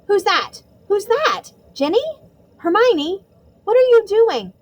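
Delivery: put-on high, alarmed girl in the dark; a young girl, startled. Ginny's startled voice.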